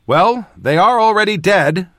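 high, loud shouted retort; shouting back. A narrator's reading. Mage shouts a quip back at Torum, shouting. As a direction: shout the answer back over the fight. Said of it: "I shouted back".

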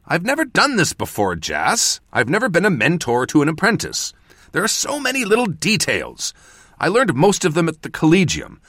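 high, pleading self-justification; agitated excuse, easing slightly toward the end; defensive. A narrator's reading. Mentor excusing inexperience to apprentice, defensive. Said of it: "I said defensively".

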